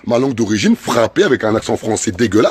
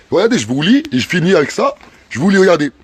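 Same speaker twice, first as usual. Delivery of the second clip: ordinary delivery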